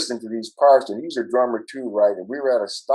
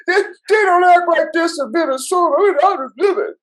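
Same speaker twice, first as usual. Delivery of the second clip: high falsetto whine, loud and laughing; mock the panicked bandmates in a loud squeaky falsetto; mock scared bandmate; 6 dB louder than usual.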